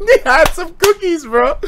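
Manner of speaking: cracking up, voice high; high giggling storytelling; laughing high. Real, not acted. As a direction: laugh it out as you tell it. Laughing while telling the story.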